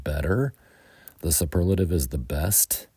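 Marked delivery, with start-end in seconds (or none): 0.0-0.5 better said with a quick D sound in the middle, bedder; flapped t. Shown as a demonstration. Teacher models better with an American D sound. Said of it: "pronounce it with a D sound".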